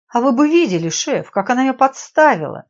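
loud, high aggrieved complaint; indignant protest. A narrator's reading. Scolded girl to her boss, indignant protest. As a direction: protest loudly and high to be heard out.

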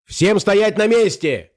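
high, drawn-out order; thundering command. A narrator's reading. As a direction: call out slowly to halt the whole room. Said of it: "прогремел громкий голос".

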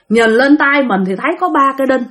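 ordinary delivery

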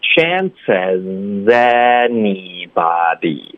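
slow, drawn-out "Chances anybody" in one chain; linked reading. Shown as a demonstration. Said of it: "这里也得连读到anybody".

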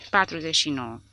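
usual speaking voice

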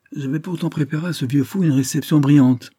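savagely amused, but without actual laughter; ferocious glee. A narrator's reading. Delmare jokes about the old man's reception, ferociously amused. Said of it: "ajouta Delmare avec un rire féroce".

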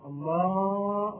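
"الله" with a long drawn-out aa before the haa; overlong vowel. Shown as a demonstration. Teacher showing the extra alif, "الله" stretched. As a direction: stretch the middle of "الله" on a held note. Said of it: "مد، زاد ألفا أخرى".

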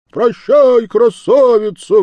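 very high, loud cry to the beauty; shouted farewell. Acted. Another lad shouts goodbye to the beauty, loud. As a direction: yell it teasing. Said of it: "вскричал другой".

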